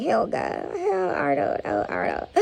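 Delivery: hammed-up lovesick voice; swooning crush. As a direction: swoon over Arnold like a smitten kid. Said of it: "somebody out there like".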